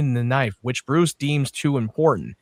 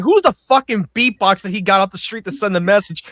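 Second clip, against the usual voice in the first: high, loud disbelief, building to the end; incredulous outburst; 7 semitones higher, 5 dB louder than usual. Retold or imitated. Co-host ranting at the absurd beatboxer plot twist, incredulous. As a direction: demand an explanation, voice climbing in disbelief.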